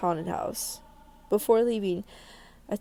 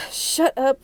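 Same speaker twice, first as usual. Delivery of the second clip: sharp louder snap to stop the praying; snapping shut up; 5 semitones higher, 7 dB louder than usual. Acted. Nervous agent snaps at a praying companion to hush. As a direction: cut off the praying friend, annoyed.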